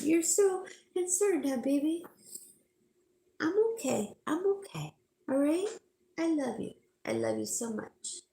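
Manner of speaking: high, sing-song cooing to a pet. Real, not acted. To her worried dog beside her, high cooing baby talk.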